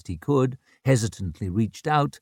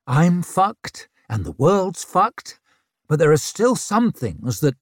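angry; 6 semitones higher, 5 dB louder than usual. Acted. Leo's anger bubbling up.

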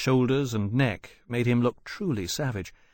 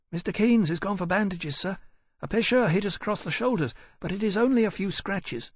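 put-on young boy voice; 8 semitones higher than usual. A narrator's reading. Boy reassuring captain.

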